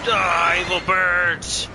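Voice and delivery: loud and drawn out; battle yell. Real, not acted. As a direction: yell it at the flock. Yelling at the attacking birds.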